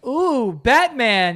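slow, high mock delight; mock excited. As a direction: stretch it out, fake impressed. Mock trick-or-treat exchange.

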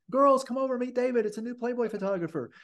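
a friendly voice calling her friends over. Retold or imitated. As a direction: summon the girls over brightly.

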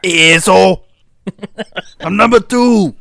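loud, high yell; shouting crab mimic.

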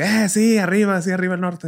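mock northern Mexican cheer; northern Mexican accent. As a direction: whoop it up like a norteña at a party. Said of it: "[name] en modo norteña".

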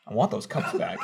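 low menacing demand. Retold or imitated. Coworker demanding his candy back.

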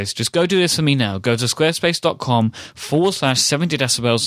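brisk, upbeat podcast ad read; sponsor ad read. Acted. Host reading the Squarespace ad to listeners, upbeat. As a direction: sell it like a cheerful host.

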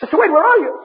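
high, loud, scared shout; frightened call. Acted. Henry calls into the dark for Wade, scared. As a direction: call out in panic.

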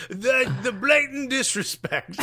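high, drawn-out mock outrage; outraged English media. Host parodying English press over a ref, mock outrage. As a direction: stretch it out like a scandalized English pundit.

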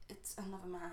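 whispered aside. Real, not acted. Friend whispers the shocking part in disbelief.

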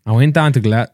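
hesitant stab at a Scottish accent; attempted Scottish accent. Shown as a demonstration. Reluctantly performing for friends, tentative Scottish accent. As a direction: start a Scottish accent, then abandon it. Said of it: "Is that a Scottish accent?".

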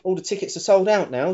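normal talking voice

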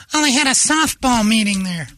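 high-pitched mock female voice; Megan. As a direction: answer in an airy put-on falsetto. Imitates Megan's reply.

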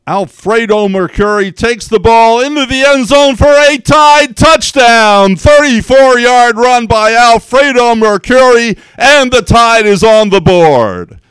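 roaring play-by-play, high, loud and stretched out; touchdown call. Shown as a demonstration. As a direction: belt it out like a stadium announcer calling a score. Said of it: "football call would be great".

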